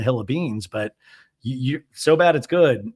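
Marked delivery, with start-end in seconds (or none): none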